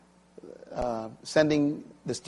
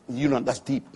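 ordinary talk

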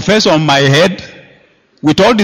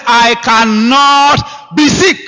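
slow, emphatic insistence; defiant declaration; 6 semitones higher, much slower than usual. Retold or imitated. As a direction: stretch each word out firmly.

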